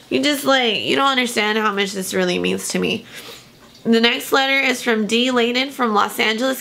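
usual speaking voice

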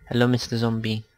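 usual speaking voice

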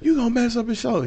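high, fast scolding; mimicking mother-in-law. Man imitating his mother-in-law scolding him, high and fast. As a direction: nag it quickly up high. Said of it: "mother-in-law scolding".

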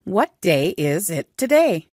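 ordinary delivery

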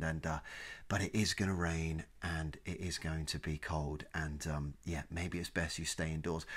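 low, soft, sorrowful weather report; solemn, apologetic forecaster. Acted. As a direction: break the bad news gently and sadly. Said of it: "they should be sad for us".

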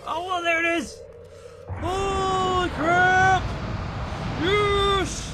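high, loud, drawn-out cheer; yelling in relief and triumph; excited shout. Real, not acted. Finally finds the item.

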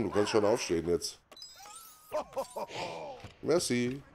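usual speaking voice

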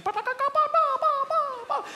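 high chirpy birdcall sounds; birdsong imitation. Comedian imitating a welcoming bird, high chirps. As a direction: chirp it out like a little woodland bird. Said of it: "making lovely noises".

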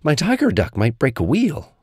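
worried Little Tiger. A narrator's reading. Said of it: "cried Little Tiger".

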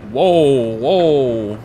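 loud, drawn-out shocked whoa; real loud whoa; startled whoa. Real, not acted. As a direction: let it burst out in shock. Sudden scare in game.

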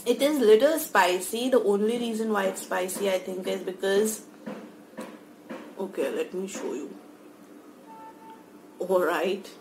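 tight-lipped chewy speech; talking while chewing. Real, not acted. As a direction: mouth partly full. Seen 0: Mouth works around food after eating; squinting, lips tight, small openings.

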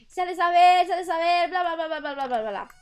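loud high crier shout; town crier. Retold or imitated. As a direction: bellow the news to the street. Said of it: "y decía".

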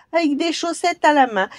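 high, loud jeering mockery; taunt, laughing at the giant; sneering goblin. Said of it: "il entendit soudain ricaner".

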